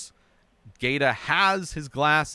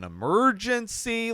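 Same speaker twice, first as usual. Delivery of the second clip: "emergency" stretched out with a swooping, raised pitch; hard stress on emergency; 9 semitones higher than usual. Retold or imitated. Retelling Brad's made-up baby story, hard stress on "emergency". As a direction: lean hard on "emergency", imitating an overdone cover story. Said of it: "really goes hard on the word emergency".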